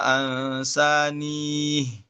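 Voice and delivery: ansanihi drawn out, ending on a long "hii"; with kasrah. Shown as a demonstration. Teacher recites Syu'bah's reading slowly. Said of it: "beliau baca".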